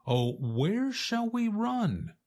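high, panicked little voice; a scared chick crying out, pitched high; frightened chick. A narrator's reading. Chick asks the fox where to flee, high and frightened.

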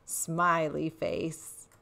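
ordinary delivery, nothing marked

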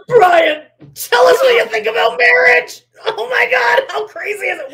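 high, loud, over-eager host; mock excited shouting. Mimicking Cody grilling Brian about marriage, mock excited shout. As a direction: holler it in hammed-up amazement.

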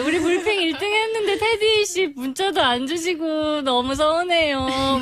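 high, slow sulky plea; whiny pleading. Pretend. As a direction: pout and whine at the boss. Mock pleading to boss.

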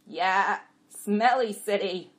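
loud, slow donkey agreement; agreeing donkey. A narrator's reading. Jack agreeing with the jenny, loud and slow. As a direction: chime in loudly, drawing the words out. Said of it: "Costel agreed".

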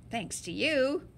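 ordinary delivery

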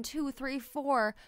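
ordinary delivery

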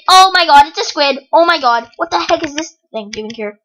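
usual speaking voice

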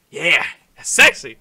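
loud, punched mock arousal; mock lustful outburst. Player admiring the painted face, mock lustful. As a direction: burst out with exaggerated appreciation. Burst after whispered crooning.

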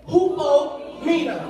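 slow, loud syllable-by-syllable chant; call-and-response lead. Shown as a demonstration. Preacher leads bilingual believers in saying it together, slow chanted lead. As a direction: draw out "Hupomeno" for everyone to follow.

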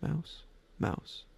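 very soft, hushed repetitions; talking to himself. A narrator's reading. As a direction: murmur each word under your breath, spaced apart. Robert still talking to himself.

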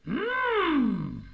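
high, loud, held "mm"; Yoda-like hum. Shown as a demonstration. Coach showing the student where to start, high Yoda hum. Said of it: "let's do the Yoda thing again, but starting from up here".

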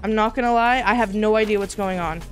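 normal talking voice

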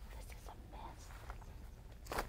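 unvoiced whisper; whispered aside. Real, not acted. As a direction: whisper it to yourself. Aside while working.

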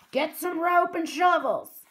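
loud, high pirate command; roar. Acted. Captain Bones orders his men about, roaring. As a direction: bellow the order to the crew. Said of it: "he roared at his men".

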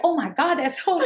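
laughing at how mean it sounds; laughing mock shock. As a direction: play up fake outrage through a laugh. Mock listener reaction, laughing.

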